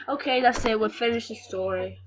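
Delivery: ordinary talk, nothing marked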